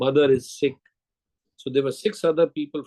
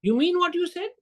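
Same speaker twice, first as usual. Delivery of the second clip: high-pitched imitation of a surprised secretary; much higher, put-on voice, questioning; secretary's voice; 14 semitones higher than usual. Secretary looks up at the flattering salesman, imitated high voice.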